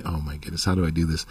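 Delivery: low, hushed self-questioning; quiet dismay. Retold or imitated. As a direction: murmur it low to yourself. Retold private panic after getting high.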